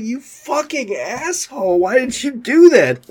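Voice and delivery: high, drawn-out mock exasperation; imitated annoyed coworker. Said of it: "He was not thrilled with me".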